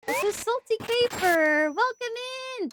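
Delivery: high, lilting welcome; excited welcome. Real, not acted. Streamer happily welcoming a new follower. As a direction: lift the name up high and happy. Greeting another new viewer.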